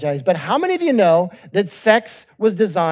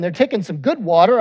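ordinary delivery

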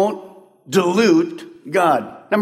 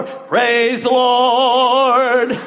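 drawn-out, sugary mock piety; pious greeting; 7 semitones higher, 4 dB louder, much slower than usual. Pastor mocking a grouchy parker turned pious at the door. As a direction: sing it out sweetly, all holy. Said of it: "you walk through the door".

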